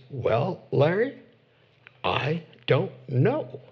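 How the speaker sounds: slow, halting pastor dodging the question; Texas pastor imitation. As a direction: imitate an evasive pastor, drawing out each word. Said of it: "that's a really good imitation of him".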